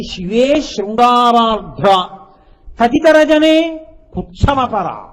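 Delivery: drawn-out rising "శివే శృంగారార్ధ్ర"; intoned quote. Acted. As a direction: stretch "శివే శృంగారార్ధ్ర" out slowly, lifting on "శివే". Repeats verse line for explanation.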